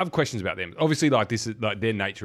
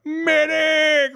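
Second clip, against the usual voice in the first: high, drawn-out yell for a medic; mock shouted call; 9 dB louder than usual. Mimicking Rico calling for a medic, high mock shout. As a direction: holler it high and loud, stretched out. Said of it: "then Rico's like".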